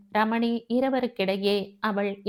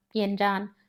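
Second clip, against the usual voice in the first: usual speaking voice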